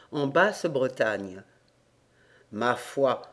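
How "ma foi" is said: low, understated grumble; lightly muttered grumble; grumbling. A narrator's reading. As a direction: grumble it under your voice in a lower pitch. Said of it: "maugréa-t-il".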